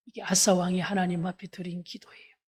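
normal talking voice

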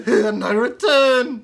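high, loud, slow puppet voice; a squeaky pig puppet making an entrance; squeaky ventriloquist dummy. Acted. Pig puppet Howard enters.

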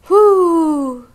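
high, loud rising-and-falling ghostly whoo; spooky cave wail. Acted. Big brother booming a spooky cave cry at his sister. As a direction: wail like a haunted cave crying out. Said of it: "read Brother with a loud expression".

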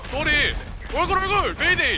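high panicked yells; panicked shouting. Real, not acted. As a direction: scream the callouts in panic. Panicked callouts in firefight.

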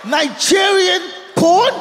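high, loud, slow incredulous question; can't believe it; loud disbelieving rise. Real, not acted. Incredulous punchline.

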